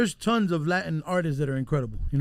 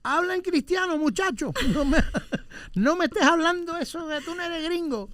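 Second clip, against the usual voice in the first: much higher scolding mother voice; imitating scolding mom; 12 semitones higher than usual. Mocking his mom scolding kids for speaking English, much higher. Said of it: "my mom hated that".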